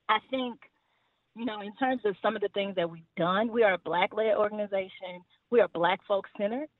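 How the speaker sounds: normal talking voice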